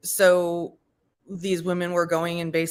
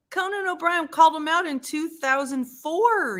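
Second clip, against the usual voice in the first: excited reveal; 10 semitones higher than usual. Real, not acted.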